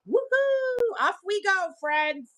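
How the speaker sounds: high, loud weekend whoop easing down; gleeful, high-pitched send-off; excited cheer. Pretend. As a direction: whoop it out like setting off on adventure.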